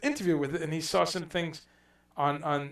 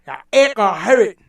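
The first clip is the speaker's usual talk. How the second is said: loud, higher Scottish accent impression; 6 semitones higher, 15 dB louder than usual. Imitating a Scot for the audience, loud Scottish accent. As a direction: belt it out in a broad Scottish accent. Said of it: "the Scottish accent".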